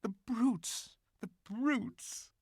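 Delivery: tearful. A narrator's reading. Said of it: "tears streaming down her cheeks".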